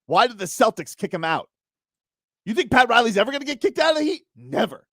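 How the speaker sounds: high-pitched, indignant sports rant; worked-up rant. Real, not acted. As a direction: fire off outraged rhetorical questions. Heated rant defending Pat Riley.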